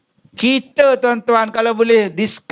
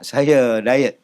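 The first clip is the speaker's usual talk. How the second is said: lower, prim excuse; lightly voiced guest; 9 semitones lower than usual. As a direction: say it like a fussy guest. Guest voiced refusing rice. (tetamu cerewet menolak nasi)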